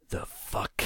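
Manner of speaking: hoarse unvoiced mutter under the breath. A narrator's reading. Injured man groggily mutters at the narrator, unvoiced. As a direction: mutter it, barely voiced and dazed. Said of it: "he muttered".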